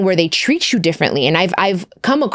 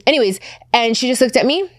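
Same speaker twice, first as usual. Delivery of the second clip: ordinary delivery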